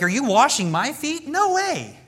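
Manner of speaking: shocked high squeak on no way; disbelieving protest, pitch jumps high; incredulous Peter. Retold or imitated. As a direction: Peter aghast at Jesus washing his feet. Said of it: "Peter's like".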